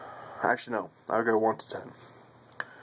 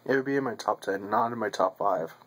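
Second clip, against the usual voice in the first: speaking with clenched pipe; talking around a pipe; faster than usual. Real, not acted. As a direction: pipe stem between teeth. Seen 1: Pipe stem clenched at mouth corner throughout, absent in R frames.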